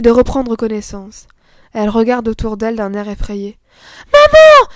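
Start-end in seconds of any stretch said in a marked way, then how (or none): 4.1-4.7 put-on much higher and louder, a little girl calling; a frightened small child crying out. A narrator's reading. The little girl wakes and calls her mother, crying out.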